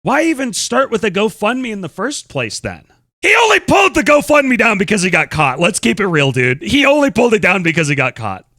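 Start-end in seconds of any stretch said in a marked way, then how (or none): 3.2-5.6 raised, higher indignant voice; heated accusation. Real, not acted. Streamer accusing the man over the GoFundMe, heated. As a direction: call him out hotly.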